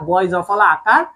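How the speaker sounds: ordinary talk, nothing marked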